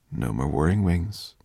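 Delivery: ordinary delivery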